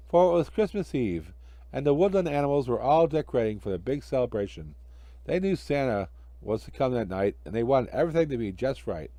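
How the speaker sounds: usual speaking voice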